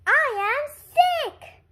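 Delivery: high loud slow joy; thrilled. Acted. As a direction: shout it with relief. Gerald delighted by diagnosis.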